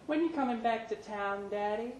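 sweet little daughter; little girl's voice. A narrator's reading. Daughter Laylene greeting her dad, small and high.

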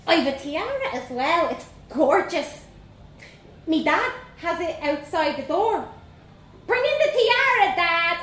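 put-on high, bold Dublin girl voice; young Dublin girl. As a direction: play a cheeky little Dublin girl. Vanessa shouts to hallway.

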